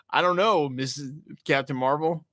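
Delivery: usual speaking voice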